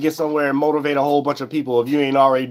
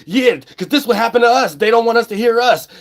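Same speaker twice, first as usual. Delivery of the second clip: mocking the fans; 7 semitones higher, 3 dB louder than usual. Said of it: "They're gonna be like".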